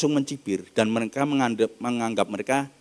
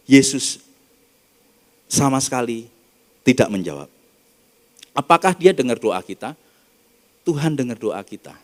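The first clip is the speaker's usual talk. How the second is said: normal talking voice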